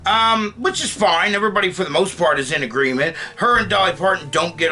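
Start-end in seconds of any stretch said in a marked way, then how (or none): none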